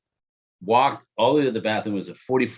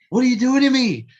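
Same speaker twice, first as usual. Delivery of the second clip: higher, louder cry of exasperation; retold outburst; 12 semitones higher, 5 dB louder than usual. Man retelling losing it at the date, loud high outburst. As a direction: blurt it out like losing it. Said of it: "Like, I just".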